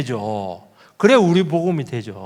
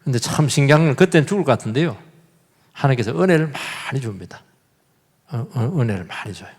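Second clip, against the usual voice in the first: ordinary talk, nothing marked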